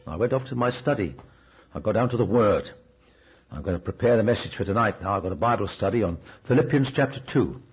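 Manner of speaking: ordinary delivery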